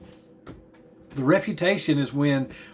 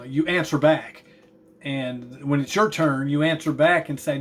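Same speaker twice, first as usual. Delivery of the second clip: ordinary talk, nothing marked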